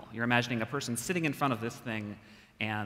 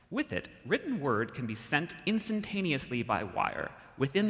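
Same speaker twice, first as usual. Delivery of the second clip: higher, grand 1950s announcer voice; old-timey radio announcer; 7 semitones higher than usual. Acted. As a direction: read it like a vintage radio ad. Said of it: "I'm gonna try to go old-timey radio announcer".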